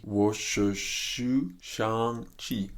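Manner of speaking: "我是徐尚气" stretched out very slowly; slow practice. Shown as a demonstration. Practising a line from the film, slow practice. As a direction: draw out each syllable of "我是徐尚气".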